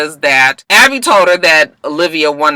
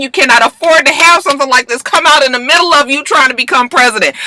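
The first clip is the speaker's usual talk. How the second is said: higher, fast bossy scolding; mock lecturing; 7 semitones higher than usual. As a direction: lay down the law like a tough sister. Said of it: "Olivia gives her that speech".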